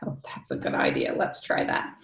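soft whispered aside; hushed aside. Real, not acted. Reader inviting children to try a deep breath, hushed aside. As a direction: drop to a whisper for the listeners.

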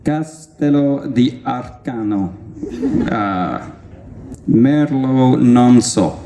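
slow, drawn-out Italian; lightly hammed Italian, stretching the vowels; Italian label reading. Reading out the wine to the audience, slow mock-grand Italian.

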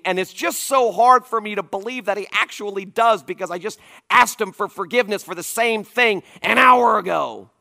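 loud, high impassioned sermon; preaching at full force. Acted. Pastor preaching on grace to his congregation, impassioned.

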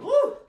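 high, loud celebratory whoop; a real joyful woo; excited whoop. Real, not acted. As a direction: cheer the class through the finish. Cheering end of round.

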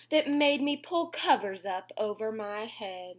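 put-on lilting read-aloud to kids; sing-song storybook reading; reading to children. A narrator's reading. Reading a bedtime rhyme to little listeners, sing-song.

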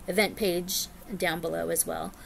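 ordinary talk, nothing marked

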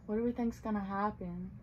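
ordinary delivery, nothing marked

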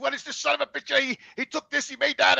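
high, worked-up complaining; fuming rant. Retold or imitated. Venting to his brother on the phone, riled up. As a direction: sputter it out all riled up.

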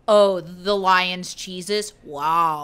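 loud, drawn-out sarcastic awe; mock contempt. Tolkien sneering at his rival's stories, sarcastic contempt. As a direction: heap on fake amazement, slow and loud. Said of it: "thought his stories were, like, such baby shit".

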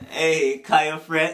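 ordinary talk

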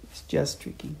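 hushed, whispered explanation to a listener; quick confidential whisper; whispered aside. Real, not acted. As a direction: whisper it softly. Reader quietly reassures listening child.

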